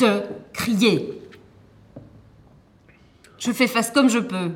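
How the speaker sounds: high, strained retort; upset protest. Acted. Club owner telling him to stop shouting, upset. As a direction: push back against the shouting, defensive.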